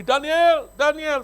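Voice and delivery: loud, sharp call of the name; harsh angry call. Retold or imitated. Father first calling his son in anger, loud. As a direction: bark the name like before. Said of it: "Kalau ini panggilannya udah beda".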